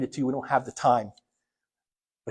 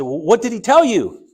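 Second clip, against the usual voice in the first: eager question; 7 semitones higher, 8 dB louder than usual. Retold or imitated. Son pressing his father.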